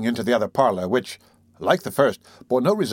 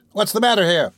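raised, higher voice; indignant demand; 8 semitones higher, 4 dB louder than usual. Acted. Humpbacked man confronts the doctor at his door, demanding. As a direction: challenge the intruder.

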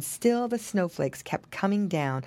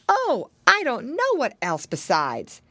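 usual speaking voice

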